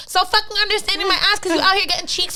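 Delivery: loud, high, rapid-fire indignation; indignant scoff. Real, not acted. Woman mocks cheating ex's claim of understanding, indignant. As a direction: throw it back in outraged disbelief.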